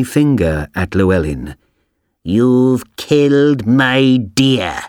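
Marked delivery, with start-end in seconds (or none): 2.2-4.8 put-on slow, drawn-out old witch accusing; angry witch. A narrator's reading. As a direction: point the bony finger and spit it out slowly. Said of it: "she said angrily".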